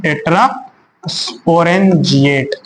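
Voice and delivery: drawn-out term. Shown as a demonstration. Teacher drilling tetrasporangiate slowly for students. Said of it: "क्या बोलते हैं?".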